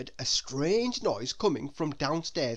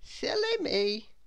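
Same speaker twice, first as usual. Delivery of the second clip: Little Miss Scatterbrain; 13 semitones higher than usual. A narrator's reading. Little Miss Scatterbrain invites guests to eat, in high voice. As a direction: chide yourself lightly, then invite, high-pitched. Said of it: "she added".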